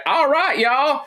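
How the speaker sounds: a bright Southern drawl; Southern accent. Retold or imitated. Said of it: "I don't know why she's Southern".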